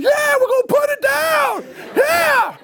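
very high oblivious whoop; acted boy's shriek; excited yelling. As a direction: holler back joyfully over the engine. Boy yelling back over saw.

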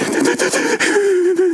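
high-pitched, stuttering mock whimper; mock crying. Macho man breaks down over the gift, mock crying. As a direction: imitate breaking into tears, hammed up. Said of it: "and I'm crying".

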